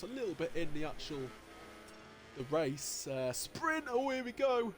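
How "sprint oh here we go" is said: excited. Real, not acted. Race start action.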